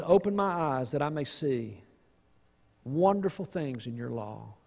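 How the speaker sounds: low and soft, prayerful; soft reverent reading. Real, not acted. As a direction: read the psalm like a hushed prayer. Quoting the psalm softly.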